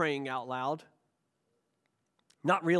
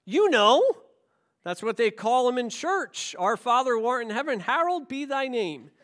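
put-on higher-pitched, matter-of-fact kid explaining; lightly voiced child; 7 semitones higher, 5 dB louder than usual. Child answering his father about church, lightly voiced and higher.

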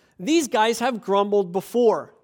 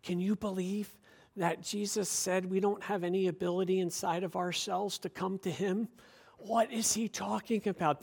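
hushed grumbling aside; mock murmur; 11 dB quieter than usual. Churchgoers grumbling about the teaching, hushed. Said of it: "the murmuring really begins".

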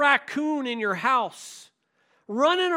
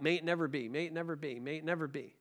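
quiet repetition; 10 semitones lower, 12 dB quieter, much faster than usual. Shown as a demonstration. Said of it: "You remember the phrase".

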